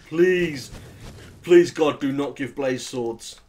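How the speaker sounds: loud, begging cry to the heavens; loud pleading. Real, not acted. Begging the game not to arm Blaze.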